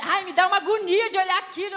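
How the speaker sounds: a touch of squeamish protest; lightly voiced mother's "ugh, I can't look"; squeamish complaint. Mother squeamish at eye trick.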